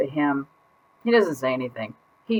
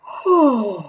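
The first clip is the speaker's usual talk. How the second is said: relieved sigh; 5 semitones higher than usual. Acted. Relieved alone in new bowl.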